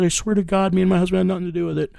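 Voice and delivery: high, rushed imitation of her swearing innocence; imitated desperate pleading. Mother swearing she and husband are innocent, imitated pleading. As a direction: rush it out high, imitating a begging woman. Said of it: "she started sobbing and crying".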